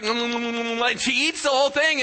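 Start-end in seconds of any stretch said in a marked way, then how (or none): 0.0-0.9 hammed-up yummy mm sounds while chewing; mock chewing hums. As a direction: hum like a bird gulping down a worm. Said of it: "she's like".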